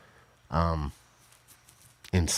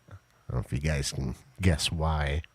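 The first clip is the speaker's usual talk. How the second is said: ordinary talk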